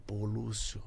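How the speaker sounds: whispering "Pô, Lúcio" almost unvoiced; hushed aside. Telling the old actor about rehearsal, in a near whisper. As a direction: keep it hushed so the others can't hear. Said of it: "Eu falei".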